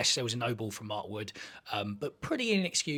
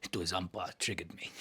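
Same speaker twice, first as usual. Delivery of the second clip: brooding South African; 6 semitones lower, 9 dB quieter than usual. Shown as a demonstration. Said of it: "sounds dark, sounds brooding".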